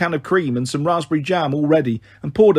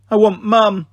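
weeping; 6 semitones higher, 5 dB louder, slower than usual. Acted. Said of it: "she wept".

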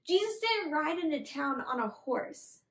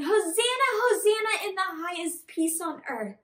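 higher, louder mock cheer, easing at the end; crowd yelling; 6 semitones higher, 5 dB louder than usual. Teacher acts crowd greeting Jesus, excited yelling. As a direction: hail him like an excited crowd. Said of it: "they're yelling".

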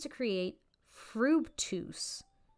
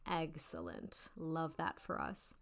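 deadpan; 5 semitones lower, 9 dB quieter, slower than usual. Acted. Deadpan reaction to game.

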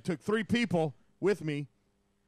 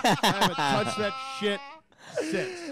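laughing; 4 semitones higher, 3 dB louder than usual. Real, not acted. Laughing at his own story.

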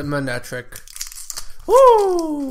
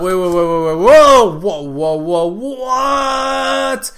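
sudden louder burst of wait and whoa; surprised; 6 dB louder, slower than usual. Real, not acted. Spotting a rare card in the pack, real surprise. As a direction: stop everything, you just saw a great card.

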